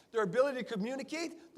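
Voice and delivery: ordinary talk, nothing marked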